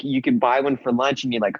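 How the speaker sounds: usual speaking voice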